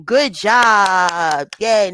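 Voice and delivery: drawn-out cheering praise; parental cheer. Real, not acted. Mom praising child who read letters, cheering. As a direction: cheer on a little one.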